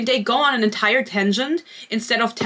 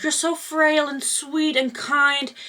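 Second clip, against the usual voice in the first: mock syrupy sarcasm; 7 semitones higher, much slower than usual. Mocking heroine's words to friend.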